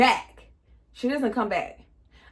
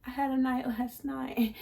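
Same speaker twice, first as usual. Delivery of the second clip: hungover roommate; 3 semitones higher, 6 dB quieter than usual. Retold or imitated. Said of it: "She turned over and she's like".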